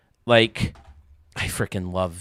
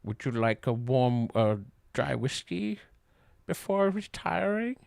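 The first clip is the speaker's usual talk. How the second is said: put-on Young Frankenstein quote; slower than usual. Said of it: "Fucking love Young Frankenstein".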